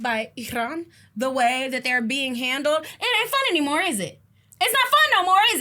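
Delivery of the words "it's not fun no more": high, loud mocking taunt. Taunting the country losing the war, high loud jeer. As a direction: rub it in.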